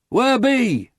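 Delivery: high, loud rustic call. A narrator's reading. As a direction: hail them through the fog. Rescuer calling out in fog.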